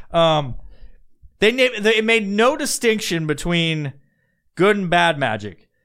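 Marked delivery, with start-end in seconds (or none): none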